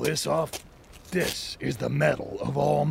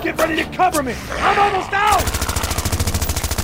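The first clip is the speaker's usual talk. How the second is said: cover me shout; 9 dB louder than usual. Acted. Firing during the fight.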